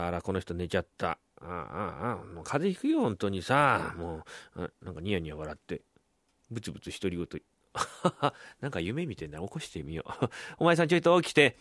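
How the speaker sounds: low, soft wife talking to herself; murmured fondly over a sleeper, then calling him; watchful wife. Acted. Wife watching her husband doze before waking him, quiet murmur.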